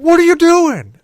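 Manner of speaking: very high, loud retold shriek of disbelief; outraged, high-pitched shout; shocked yell. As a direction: imitate a brother yelling in horror. Brother catches him peeing in hallway.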